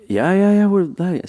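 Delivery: high, loud eager yes; eager agreement. Retold or imitated. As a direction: jump in keenly agreeing. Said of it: "they're like".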